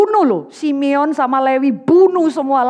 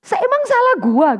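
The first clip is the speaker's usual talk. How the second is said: higher, mock rude comeback; rude modern husband; 9 semitones higher than usual. Modern husband snapping back at his wife, mock rude. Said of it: "bisa jawab yang kasar".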